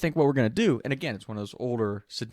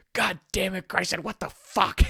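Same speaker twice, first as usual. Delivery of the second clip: cussing him out; 9 semitones higher than usual. Retold or imitated. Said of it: "Just cussing him out".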